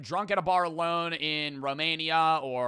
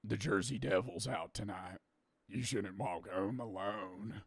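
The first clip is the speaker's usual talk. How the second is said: spooky barfly; 7 semitones lower, 8 dB quieter than usual. Retold or imitated. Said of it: "somebody being like".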